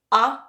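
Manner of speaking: a lone "a", cut short; short A sound. Shown as a demonstration. Teacher demonstrates the unstressed vowel, as a short "a". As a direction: say "a" as a brief, isolated vowel. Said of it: "always sounds like a short".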